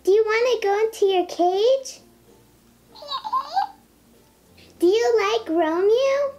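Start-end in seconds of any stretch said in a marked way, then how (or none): 2.9-3.7 high squeaky sped-up echo of the toy; a tiny pet toy chirping the words back; toy chipmunk echo. Real, not acted. Talking pet toy repeating a girl's greeting, high and squeaky.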